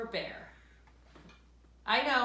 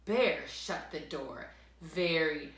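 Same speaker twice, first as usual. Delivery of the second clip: ordinary delivery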